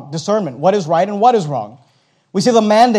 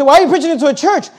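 raised, fast challenging question; mock objection; 10 semitones higher, 6 dB louder, faster than usual. Congregant challenging the pastor, raised and fast. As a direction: voice the doubter in the pews, quickly.

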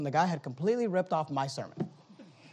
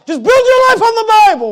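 much higher, much louder cry; shouted climax; 18 dB louder than usual. Acted. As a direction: belt the line out to the pews. Preaching climax vs quiet repeat.

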